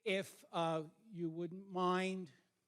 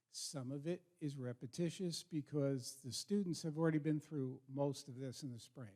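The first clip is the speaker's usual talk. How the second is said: ordinary talk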